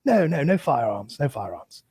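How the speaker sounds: imitating a polite English bobby. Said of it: "when the English Bobby cop comes over".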